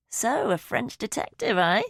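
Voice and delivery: mischievous ribbing of a dad; teasing. A narrator's reading. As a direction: tease him lightly with a lifted voice. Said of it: "I'm teasing".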